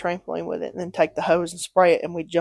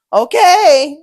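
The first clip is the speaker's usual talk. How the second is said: higher, louder, drawn-out okay; exasperated okay; 8 semitones higher, 9 dB louder than usual. Retold or imitated. Child to dad after a repeated warning, exasperated. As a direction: agree loudly, like you've been told already.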